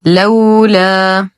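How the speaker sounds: slow chanted word, vowels held; Quran recitation. Shown as a demonstration. Teacher models the next word for learners, slow recitation. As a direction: recite it in measured tajweed.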